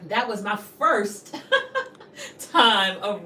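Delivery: laughing through the words. Real, not acted. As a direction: break into a laugh mid-phrase. Laughs mid-sentence.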